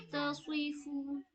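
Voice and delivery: तस्वीफु said slowly with a full, heavy स्वाद; heavy swad drill. Shown as a demonstration. Teacher showing pupils the heavy letter, slowly. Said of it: "इसमें स्वाद पुर है।".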